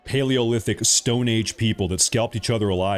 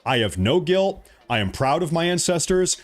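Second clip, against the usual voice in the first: ordinary talk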